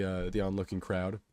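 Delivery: plain reading voice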